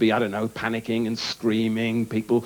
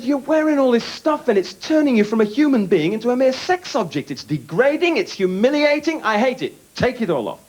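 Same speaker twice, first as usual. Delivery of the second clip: indignant lecture; 13 semitones higher, 4 dB louder than usual. Retold or imitated. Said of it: "I said".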